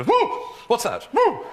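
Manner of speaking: high, startled mock horse voice; shy at nothing with a whooped alarm; hammed-up skittish horse; spooked horse. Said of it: "every day they just go".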